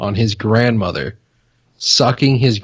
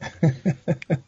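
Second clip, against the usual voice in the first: laughing; 6 semitones higher, 7 dB quieter than usual. Real, not acted. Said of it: "That's pretty good".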